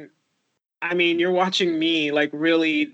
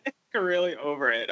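usual speaking voice

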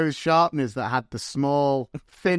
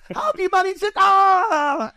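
mock high exasperated voice; 5 dB louder than usual. Mock teacher retold.

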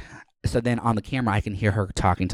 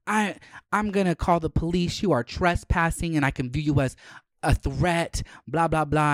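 lightly raised mocking voice of the neighbor; 7 semitones higher than usual. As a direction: mimic her prissy threat. Mimicking neighbor threatening police.

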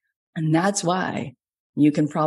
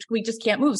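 ordinary delivery

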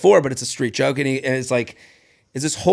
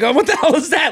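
imitating an uncle's outraged "what the hell", pitched much higher; mock-shocked uncle; 10 semitones higher than usual. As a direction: play the baffled uncle, higher and incredulous. Uncle reacting to bad joke.

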